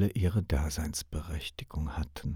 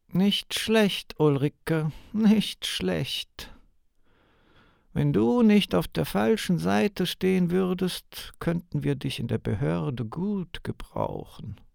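grudging interrogator; 10 semitones higher than usual. Acted.

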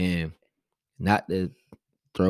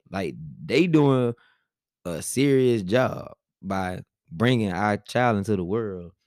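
usual speaking voice

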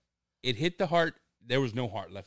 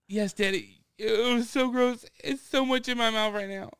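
disgusted daughter; 13 semitones higher, 6 dB louder than usual. Retold or imitated. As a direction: whine it like a grossed-out kid. Daughter quoted, gagging.

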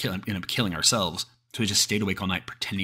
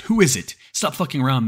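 raised, higher, louder yell; 7 semitones higher, 7 dB louder than usual. A narrator's reading. Frightened group in the cabin to the thing outside, yelling. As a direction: call out loudly through a door. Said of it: "We keep yelling outside".

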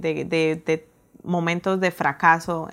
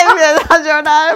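laughing; 8 semitones higher, 11 dB louder than usual. Real, not acted. Laughing while retelling tears.

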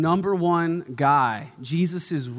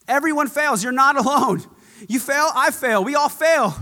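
much higher, louder preaching climax; rising exhortation; 8 semitones higher, 4 dB louder than usual. Real, not acted. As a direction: build it to a ringing cry. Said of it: "preacher builds intensity".

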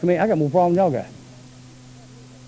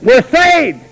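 ordinary talk

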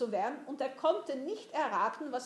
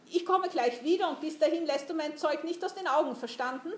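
loud, higher-pitched officer's order; commanding tone; 5 semitones higher, 4 dB louder than usual. A narrator's reading. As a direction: order the boy about, brooking no refusal. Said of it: "den Befehlston des Mannes".

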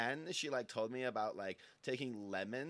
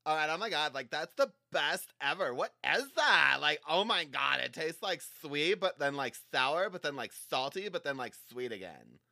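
excited; 4 semitones higher, 7 dB louder than usual. Acted. Excited rant about the taste.

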